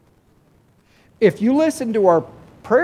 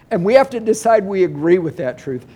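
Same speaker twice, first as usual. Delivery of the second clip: normal talking voice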